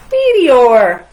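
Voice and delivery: a loud, proud announcement; triumphant reveal. A narrator's reading. Narrator revealing the commander's surprise pick, loud and proud. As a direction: land the surprise name big.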